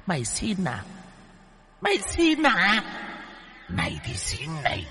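high, eerie demon voice mocking; demon woman. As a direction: sneer like a she-demon whipping him. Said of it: "Con nữ quỷ vừa nói". (giọng nữ quỷ the thé chế nhạo)

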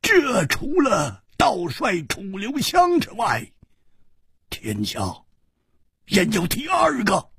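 high-pitched, ringing insistence; emphatic declaration. A narrator's reading. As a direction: declare it in high bursts, pausing between phrases. Acted heated challenge in dialogue. (高声断言)

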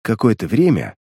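ordinary delivery, nothing marked

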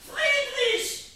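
high, loud desperate call; panicked shout. Acted. Mother screaming for her husband after seeing blood. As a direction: scream his name in fear.

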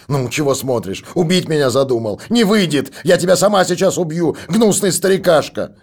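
rising to a high, loud threatening yell; breaking into a scream. A narrator's reading. Daughter threatens the old man, breaking into a scream. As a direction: snarl it and let it crack into a scream. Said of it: "срываясь на крик".

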